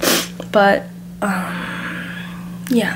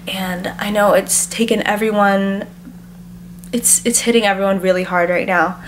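normal talking voice

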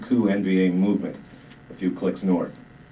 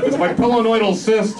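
high, loud, winded whine; huffed whine; 14 semitones higher, 6 dB louder than usual. Acted. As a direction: puff it out while struggling to keep up. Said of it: "huffed Limbaugh".

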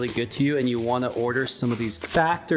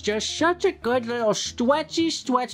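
very high, sing-song pet voice; 9 semitones higher than usual. Real, not acted. Baby talk playing with dog.

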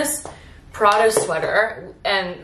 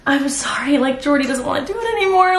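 apologetic retelling; 6 semitones higher than usual. Retold or imitated. Retelling awkward cancellation message.

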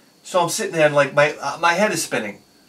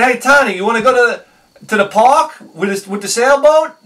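calling out to Tony, high and loud; hollering an eager invite across the room; 8 semitones higher, 10 dB louder than usual. Retold or imitated. Loudly inviting Tony to the park.

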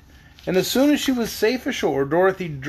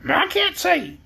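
high hen voice; reply as a talking hen; high-pitched hen, disclaiming; yellow hen; 11 semitones higher, slower than usual. A narrator's reading. Hen replies to the girl.

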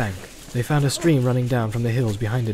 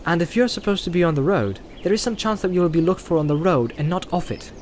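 a lightly voiced Frodo, higher, making his case; Frodo, reasoning; 6 semitones higher than usual. A narrator's reading. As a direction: speak as a hobbit proposing a shortcut. Frodo's dialogue in read story.

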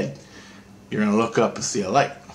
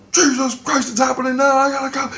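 very high, louder excited voice; the other Christ, urgent; 3 dB louder than usual. Retold or imitated. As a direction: squeak it out in a hurry. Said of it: "He goes".

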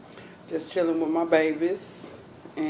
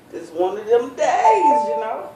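laughing; 7 semitones higher, 3 dB louder than usual. Real, not acted. Laughing at her rough look.

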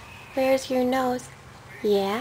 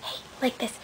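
quiet bedtime whisper; 3 dB quieter than usual. Acted. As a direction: keep it hushed and gentle. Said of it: "we had to be quiet".

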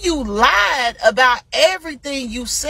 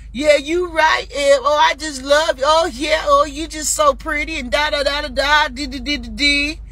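higher-pitched, gushing mock fan chatter; mocking commenters; 5 semitones higher than usual. Host mimics dumb idiots in the comments, mocking. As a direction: parrot the fawning commenters, tailing into da-da-dee. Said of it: "them dumb idiots sit in the comments".

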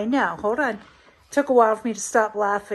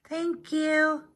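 pitched-up little pet voice, a light put-on; voicing the pet; 5 semitones higher than usual. Owner speaks for Mary thanking Kathy, cute pet voice.

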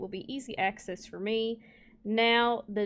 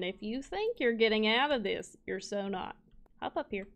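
ordinary delivery

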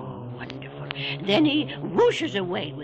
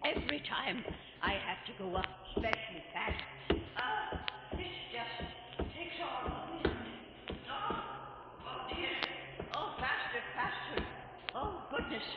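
much softer, breathless, gasping climb; out of breath; 12 dB quieter than usual. Acted. Keeper rushing up lighthouse stairs, out of breath. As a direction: pant your way up the stairs. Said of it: "gets me out of breath".

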